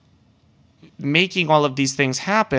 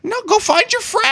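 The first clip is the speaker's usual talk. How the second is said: much higher, mocking exasperated voice; exasperated brush-off. Retelling brushing off a drunk stranger, mock exasperated high voice. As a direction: shoo her off in a put-on squeaky tone.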